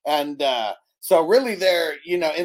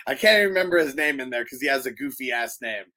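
ordinary delivery

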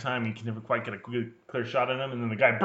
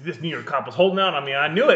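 mock indignant cop; 4 semitones higher, 7 dB louder than usual.

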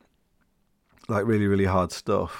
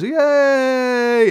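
high, loud mock cheer; a much higher, louder hammed-up yay; crowd cheer; 7 dB louder than usual. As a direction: cheer up at the bridge like a boat of tourists. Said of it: "everyone on the boat goes".

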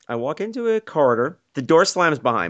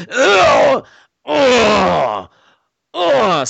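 loud, high mock straining groan; hammed-up toilet grunts; imitated straining groans; 8 semitones higher, 6 dB louder, much slower than usual. Man heard through the stall door, straining groans.

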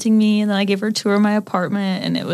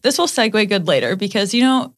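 usual speaking voice

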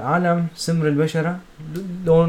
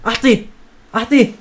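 loud high urgent calling; 6 semitones higher, 8 dB louder than usual. Retold or imitated. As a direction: call out urgently. Retelling urgent call to mom.

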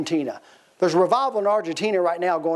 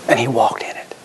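whispered aside; hushed; 7 semitones lower than usual. Real, not acted. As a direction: breathe it to the congregation. Preacher drops to hush.

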